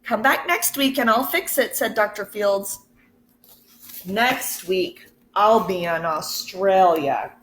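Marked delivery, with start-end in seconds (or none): none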